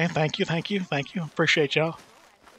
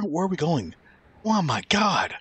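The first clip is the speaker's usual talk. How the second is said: ordinary talk, nothing marked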